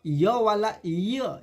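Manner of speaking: "य वाला य" drawn out slowly with a clear y; slow demonstration. Shown as a demonstration. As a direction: stretch the "य" so the glide is heard. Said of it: "हमें क्या पढ़ना है". ("य वाला य" को धीरे, खींचकर साफ़ बोलें)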